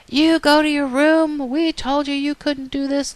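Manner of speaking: high, slow, drawn-out scolding; acted upset telling-off; upset parents. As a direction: play an exasperated parent sending a child away. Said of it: "this really gets the parents upset".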